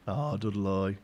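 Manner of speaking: "Dudley" ending in a drawn-out Brummie -loy; Brummie accent. Shown as a demonstration. Streamer showing viewers the local pronunciation, Brummie DUD-loy. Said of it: "extra syllables into Dudley".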